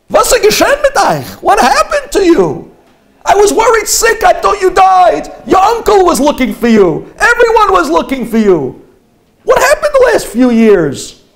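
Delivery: father frantic over his missing son, loud and high; worried old father. Retold or imitated. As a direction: scold him with worry like an anxious dad. Father's worried telegram, acted.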